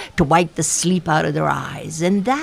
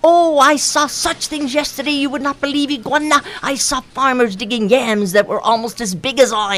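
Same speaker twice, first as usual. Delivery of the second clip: boastful Mosquito; 7 semitones higher than usual. Acted.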